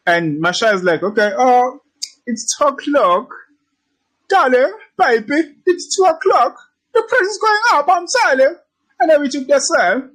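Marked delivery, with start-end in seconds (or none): 1.0-8.6 put-on high-pitched hammy German accent. Trader telling darling the price is going up, mock German accent. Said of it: "that is my German accent". 9.0-10.1 put-on high, playful German accent. Trader reporting the trade afterwards, mock German accent. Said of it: "that is my German accent".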